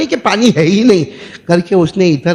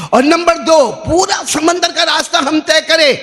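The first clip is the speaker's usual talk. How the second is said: high, raised preaching pitch; emphatic announcement; 11 semitones higher than usual. Acted. Prophet setting his second condition for crossing, emphatic. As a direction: announce the rule with full pulpit force.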